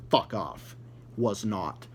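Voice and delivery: ordinary talk, nothing marked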